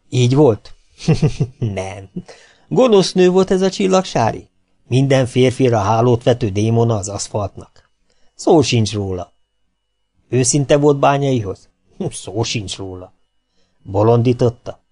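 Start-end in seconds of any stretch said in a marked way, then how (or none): 1.0-1.5 a brief amused chuckle; short laugh. Real, not acted. Narrator laughing at his own question to listeners, short chuckle. 12.0-13.1 "Szó sincs róla" said through a soft laugh, slow; laughing denial. Real, not acted. Narrator answering his own question about her, laughing softly.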